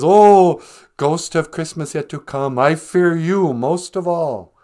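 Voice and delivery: theatrical frightened plea, loud opening "Oh"; mock fearful Scrooge. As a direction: play Scrooge dreading the ghost, hammed-up. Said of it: "Scrooge just trembling in bed".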